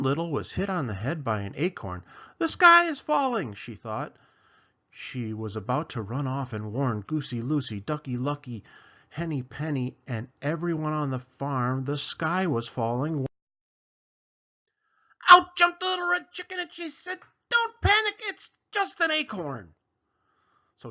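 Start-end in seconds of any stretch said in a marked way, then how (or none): 2.4-3.5 high, loud mock panic; panicked cry. Chicken Little panics, high and loud. 15.3-19.8 very high, loud chicken warning; chicken bursting in. Acted. Chicken barges into the story, shrieking high.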